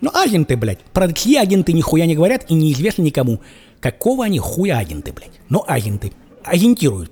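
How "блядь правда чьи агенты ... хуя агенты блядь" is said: ordinary talk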